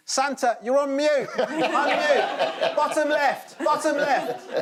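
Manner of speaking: high, loud, frantic yelling; desperate child shouting. Retold or imitated. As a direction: yell frantically like a desperate kid. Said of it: "the desperate child shouting".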